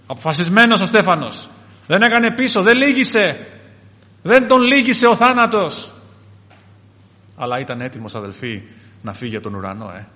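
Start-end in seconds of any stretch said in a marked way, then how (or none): 0.1-5.9 loud, high, defiant declaration; raised pulpit proclamation; impassioned preaching. Real, not acted. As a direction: drive each phrase home harder. Preacher exalts Stephen's resolve.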